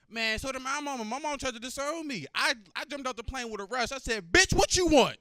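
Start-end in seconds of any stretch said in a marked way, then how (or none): 4.3-5.2 shrill loud angry snap, much higher; angry mom. Retold or imitated. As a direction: bark it like a furious mother answering the phone. Mom answering phone angrily.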